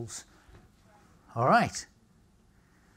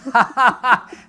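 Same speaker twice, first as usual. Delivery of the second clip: loud, high hearty laugh; real sudden laugh; burst of laughter; 8 semitones higher, 12 dB louder than usual. Real, not acted. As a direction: crack up at the question. Amused by question.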